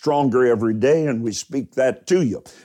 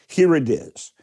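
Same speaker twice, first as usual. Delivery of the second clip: ordinary delivery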